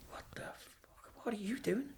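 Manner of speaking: very soft, whispered mock bewilderment; whispered disbelief. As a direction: imitate a baffled copper, barely audible. Retold copper staring in disbelief.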